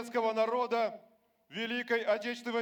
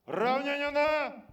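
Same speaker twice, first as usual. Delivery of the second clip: barked parade commands, loud; drawn-out drill command, then snap; shouting drill sergeant; 3 semitones higher, 4 dB louder, slower than usual. Real, not acted.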